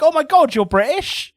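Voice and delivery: mocking Americans amazed by a Brit; gushing American. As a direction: imitate a starstruck American. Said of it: "they're like".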